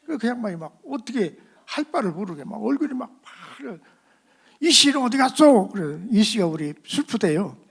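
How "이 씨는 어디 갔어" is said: loud, higher demand; irritated demand. Retold or imitated. The dean demanding where Mr. Lee went, loud and higher. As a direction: snap the question out in irritation.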